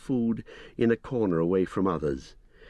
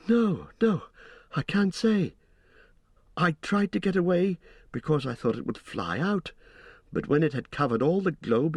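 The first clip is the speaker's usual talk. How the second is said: put-on a higher young hobbit voice, frightened; Pippin; 7 semitones higher than usual. A narrator's reading. Frightened hobbit telling Gandalf what he saw, high and shaken.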